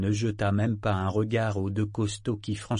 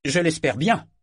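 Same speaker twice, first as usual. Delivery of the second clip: loud, raised vehement retort; 5 semitones higher, 5 dB louder than usual. A narrator's reading. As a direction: fire it back with force. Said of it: "renchérit Tenira avec véhémence".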